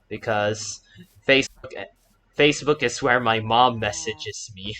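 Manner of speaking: normal talking voice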